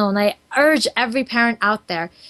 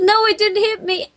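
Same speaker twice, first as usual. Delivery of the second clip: put-on high little boy's voice; child voice; 10 semitones higher than usual. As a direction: pitch up like a young son. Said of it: "He said".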